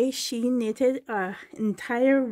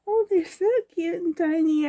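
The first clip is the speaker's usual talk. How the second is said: high, louder coo of delight; gushing delight; 6 semitones higher, 5 dB louder than usual. Real, not acted. Admiring cute tiny outfits aloud, gushing delight. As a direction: squeal over something tiny.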